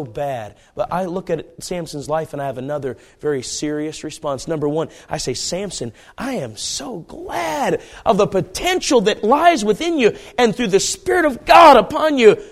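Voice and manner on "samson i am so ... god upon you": jubilant exclamation. Acted. Preacher telling Samson how glad he is, jubilant. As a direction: proclaim it with preacher's gusto.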